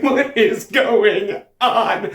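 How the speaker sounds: loud, high, very drawn-out question; startled alarm. Acted. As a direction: stretch each word out in alarm. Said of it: "springs back up and he's like".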